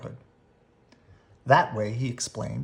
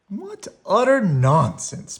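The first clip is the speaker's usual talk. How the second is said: protesting; 8 semitones higher, 6 dB louder, slower than usual. A narrator's reading. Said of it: "protested Mama".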